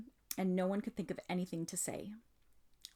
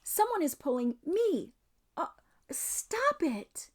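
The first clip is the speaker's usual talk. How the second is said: high, panicky cry for help; scared plea rising to a shriek; frightened; 10 semitones higher, 4 dB louder, slower than usual. Acted. Susan being dragged by magic.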